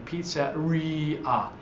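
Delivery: pizzeria said slowly with the weight on ri; slow pizzeria, leaning on the I; stressed I. Shown as a demonstration. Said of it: "because of that emphasis".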